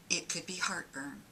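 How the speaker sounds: normal talking voice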